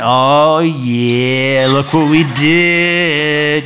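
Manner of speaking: slow, smug put-on accent, lightly done. Preacher mocks proud disciples in a slow smug put-on accent. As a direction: show off like a disciple full of himself. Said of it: "talking with an accent".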